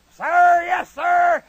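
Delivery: loud, high military response; recruit's shouted reply. Acted. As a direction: shout it up to the drill instructor. Recruit answering his drill instructor.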